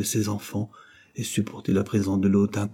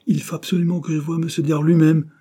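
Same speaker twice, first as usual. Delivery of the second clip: higher, louder, emphatic insistence; insistent; 8 semitones higher, 6 dB louder than usual. A narrator's reading. Jane to a clerk, demanding to see monsieur Dare, insistent. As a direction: press the demand with raised pitch and volume.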